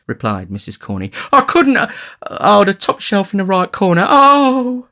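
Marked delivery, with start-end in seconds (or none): 1.3-4.9 very high, loud, incoherent distress; distracted lady. A narrator's reading. Matron pointing distractedly to the cupboard, wailing. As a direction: wail the directions, ending on a long high cry. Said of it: "thus incoherently indicated".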